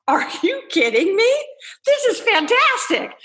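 high, rising excited exclamation; thrilled disbelief. Retold or imitated. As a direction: act out an elated realisation. Retold thrilled realisation after being cussed out.

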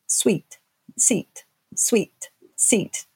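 sweet, seat, sweet, seat spoken slowly with an even beat on each vowel; rhythmic beat on each word. Shown as a demonstration. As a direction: tap out sweet, seat, sweet, seat like a metronome. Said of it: "rhythmically on purpose".

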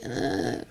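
whispered breathy moan; barely voiced sleep groan; faint moan.

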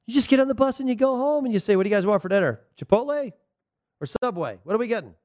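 high-pitched, breezy imitation of an unbothered coach; mock nonchalance. Host mimics a coach shrugging off losses at home, mock nonchalant.

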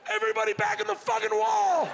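high, loud command to retreat; barked order. Retold or imitated. Dad ordering the family back into the wall, high loud command. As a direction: herd everyone back in a hurry.

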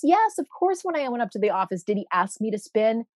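ordinary delivery

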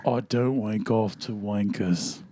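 put-on low, soft English accent; deep English accent. Showing the accent practised while driving, deep English voice. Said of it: "English accent".

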